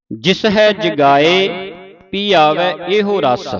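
measured, slow chant of the verse; slow scripture recitation. Acted. Teacher reciting gurbani slowly for a student, measured and deliberate.

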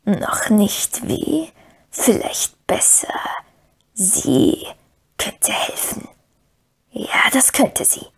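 slow sly whisper; scheming Gollum whisper. A narrator's reading. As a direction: plot it slowly, hissing.

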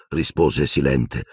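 usual speaking voice

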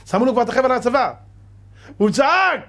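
rising alarm. Acted. Preacher to congregation, telling of the king's cry, with rising urgency. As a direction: build it up to the cry. Preacher dramatizes Jehoshaphat's peril.

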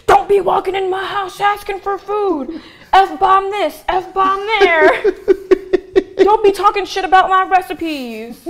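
high, drawn-out mock shout; mock scolding. Laying down house rules to a guest, mock scolding.

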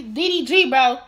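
ordinary talk, nothing marked

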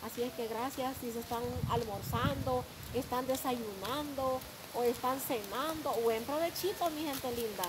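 loud cheerful thanks to viewers; vlog address. Acted. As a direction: greet your audience warmly.

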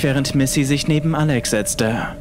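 usual speaking voice

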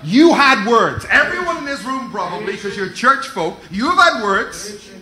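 full-force preaching. Real, not acted. Pastor urging church to fight for prophecies, loud preaching. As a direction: drive the words home to the church.

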